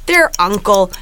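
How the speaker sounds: put-on light Minnesota Fargo accent, slowed in places; put-on Fargo Midwest accent. Host telling the plot for the game in a Fargo accent. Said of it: "that was a solid accent right there".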